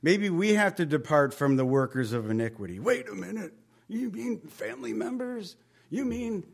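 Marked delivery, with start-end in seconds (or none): 2.8-6.4 higher-pitched, hesitant disbelief; mock taken-aback objector. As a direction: act the listener balking at the idea. Acts out a hesitant objecting listener.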